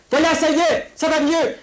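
very high, yelled encouragement; shouted reassurance. Acted. Telling the child it is better, shouting. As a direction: shout comfort as if to a frightened child. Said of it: "je ne crie que pour te faire".

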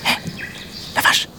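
whispery tiny insect hail; tiny insect calling; tiny little voice. Acted. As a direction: call out in a voiceless squeak. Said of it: "une toute petite voix".

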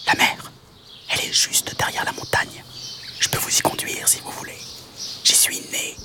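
whispered praying mantis; tiny whisper voice. Acted. Mantis offers to guide them to the sea, tiny whisper. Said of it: "une toute petite voix".

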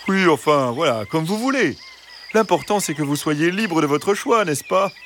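high, ingratiating fox voice; smooth-talking fox. Acted. Fox glossing over Josette's objection, ingratiating. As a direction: brush it off airily, with a small chuckle.

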